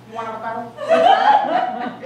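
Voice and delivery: put-on very high, slow, laughing Filipino accent; Filipino lady, laughing. Filipino sandwich lady pushing avocado, laughing Filipino accent. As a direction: stretch it out, cracking up. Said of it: "there was this Filipino lady there".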